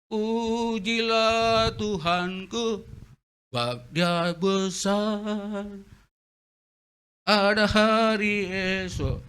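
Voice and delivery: high, very slow, sing-song holy voice; mock pious praise. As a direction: drag out the piety like a hypocrite. Pious words, hypocrite mocked.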